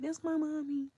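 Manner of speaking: put-on high little-kid voice; a toddler proudly naming his mother; imitating a little boy. Mother quoting her son again, high-pitched. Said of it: "So to hear him be like".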